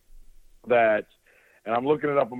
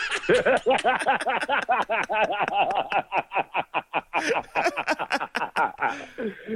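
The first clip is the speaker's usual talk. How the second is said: real drawn-out laughing fit; long laugh; 10 semitones higher than usual. Real, not acted. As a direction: laugh at your own comeback. After own punchline.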